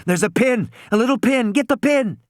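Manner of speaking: high, urgent shouting. A narrator's reading. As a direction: yell the orders fast. Urgent instructions.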